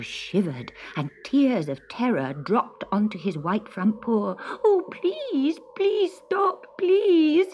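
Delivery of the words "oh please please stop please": high-pitched kitten begging; pleading cry. A narrator's reading. Frightened kitten begs the witch to stop, high pleading cry. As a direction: beg desperately in a tiny high voice. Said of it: "he cried".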